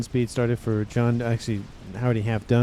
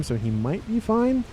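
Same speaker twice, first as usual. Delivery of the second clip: "might" stressed with a raised, hedging pitch; 9 semitones higher than usual. Real, not acted. Caster hedging on an exposed player's chances, stressing "might". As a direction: lean on "might", lifted high. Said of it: "emphasis on the word might".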